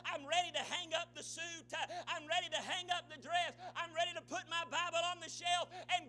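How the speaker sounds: loud, high, rhythmic sermon build; full-force preaching. Real, not acted. Preacher voices those ready to give up, fervent preaching. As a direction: hammer out each repeated phrase.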